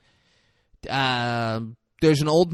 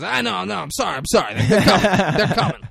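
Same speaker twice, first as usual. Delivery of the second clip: high, loud, rushed-sounding excuses; mock-panicked apologising; flustered apology; 7 semitones higher, 8 dB louder than usual. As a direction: plead as the harried cook, laughter creeping in. Acted cook apologising when challenged.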